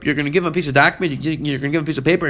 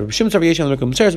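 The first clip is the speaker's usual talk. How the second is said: ordinary delivery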